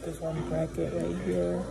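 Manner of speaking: talking with lip held down; showing teeth while speaking. Real, not acted. Seen 0: Long-nailed finger pulls lower lip down to show braces bracket.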